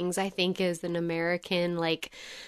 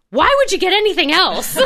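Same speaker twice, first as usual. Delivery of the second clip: high, loud, amused incredulous quip; old gentleman at the bar; 10 semitones higher, 9 dB louder than usual. Retold or imitated. Retelling the old man's reply to ordering Guinness, loud and amused. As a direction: imitate the old man, laughing at the very idea.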